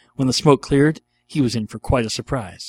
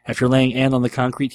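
ordinary delivery

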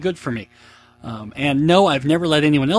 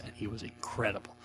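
ordinary delivery

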